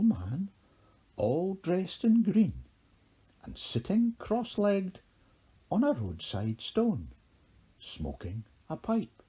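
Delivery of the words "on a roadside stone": ordinary delivery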